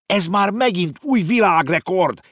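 put-on high, loud boyish cry; exclaimed. A narrator's reading. Konrád cheering the race result, excited. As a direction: shout out in delight. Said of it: "kiáltott fel Konrád".

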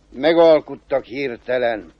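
usual speaking voice

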